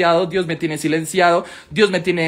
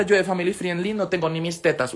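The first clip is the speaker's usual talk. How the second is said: usual speaking voice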